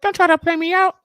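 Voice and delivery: squeaky helium voice, very high and loud. Pretend. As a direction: talk like you sucked a balloon. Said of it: "that's why I did the helium voice".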